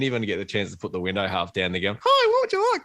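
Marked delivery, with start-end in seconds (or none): 2.0-2.8 very high, bright squeaky service voice; perky drive-through greeting. Retold or imitated. Drive-through attendant cutting in instantly, squeaky and high. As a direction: chirp it like an eager drive-through worker.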